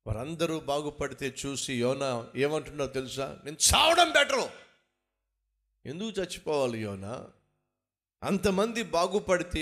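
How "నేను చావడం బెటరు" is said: high, loud despairing cry; sudden loud cry of despair; Jonah's outburst. Acted. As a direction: wail it out as the fed-up prophet. Preacher voices Jonah's complaint.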